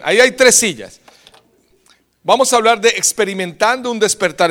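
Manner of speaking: loud, high preaching to the crowd; full-force preaching. Acted. Preacher rousing the church, loud and high.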